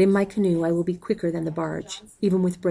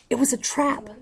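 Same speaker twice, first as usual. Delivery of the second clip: urgent cry; 6 semitones higher than usual. A narrator's reading. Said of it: "the man cries".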